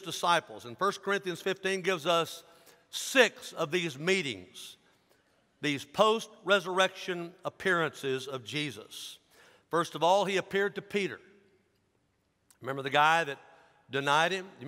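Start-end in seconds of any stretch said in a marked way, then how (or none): none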